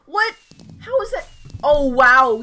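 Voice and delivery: high, loud shocked outburst; loud, high-pitched amazement; real disbelief. Real, not acted. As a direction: burst out astonished at the result. Shocked by the final score.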